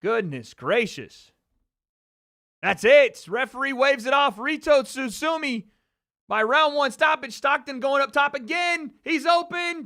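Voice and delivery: loud, high live fight call; wrestling commentator. Real, not acted. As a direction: call every punch at full volume. Live boxing play-by-play.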